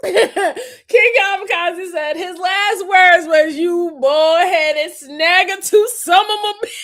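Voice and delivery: fighting laughter to read it out; laugh-shouting the line. Real, not acted. Reading a chat line, cracking up.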